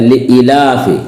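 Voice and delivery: लिईलाफि with a short second i; common wrong reading. Shown as a demonstration. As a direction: pass quickly over the "ee" in लिईलाफि. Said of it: "अक्सर लोग गलत करते हैं". (लिईलाफि में ई छोटी, ग़लत)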